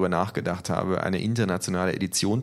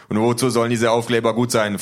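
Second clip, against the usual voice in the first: loud, higher, impatient question; indignant; 4 semitones higher, 7 dB louder than usual. Acted. Official questioning the stickers, loudly indignant. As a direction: demand it with raised voice, annoyed. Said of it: "fragt der Mann ungehalten".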